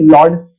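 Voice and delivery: "लॉर्ड" drawn out, ending in d; D sound for T. Shown as a demonstration. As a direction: slowly model lot as "लॉर्ड". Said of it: "इसको डी sound pronounce करते हैं".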